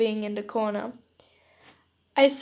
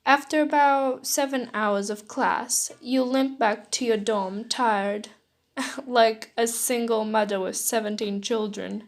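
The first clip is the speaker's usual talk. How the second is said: ordinary delivery, nothing marked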